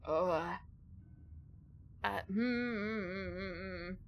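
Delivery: humming with the jaw pushed out; jaw stuck out. Real, not acted. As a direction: test the sound with the jaw jutting forward. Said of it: "I'm trying to stick out my jaw".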